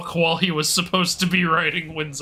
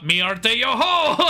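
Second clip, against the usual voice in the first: hammed-up pirate cheer; 6 semitones higher, 3 dB louder, much slower than usual. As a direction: play a jolly pirate. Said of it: "sound like a pirate word".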